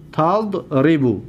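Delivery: تضربو slowly split as tad-ribu; syllable by syllable. Shown as a demonstration. Teacher showing students the vowel pattern, syllable by syllable. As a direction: break تضربو after the closed first syllable tad. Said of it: "دقت بتید".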